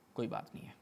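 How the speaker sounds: hushed throwaway whisper; whispered aside. Real, not acted. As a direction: drop to a breathy whisper. Muttered aside after embarrassing report.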